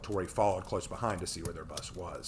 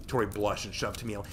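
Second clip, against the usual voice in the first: ordinary delivery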